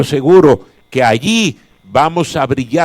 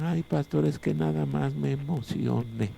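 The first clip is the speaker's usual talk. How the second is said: much softer, whining excuse; whiny believer; 13 dB quieter than usual. Retold or imitated. Pastor mocks Christians excusing themselves to him, soft whining. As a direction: sigh out the excuse like a limp complainer. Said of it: "que dicen".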